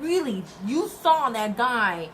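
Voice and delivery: high, loud, incredulous really; mock outrage at a useless bystander; disbelief. Scolding the UPS guy in disbelief, loud and high. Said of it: "Like, in my head".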